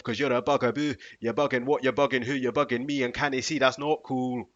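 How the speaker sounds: sung rap in a lightly put-on Scottish accent; a hint of Scottish, sung loud. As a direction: rap the tune as a Scottish man would. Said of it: "in a Scottish man's accent".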